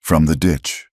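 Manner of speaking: ordinary delivery, nothing marked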